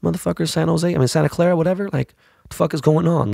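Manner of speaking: like you can't believe the hotel email; retold disbelief. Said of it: "And I'm like".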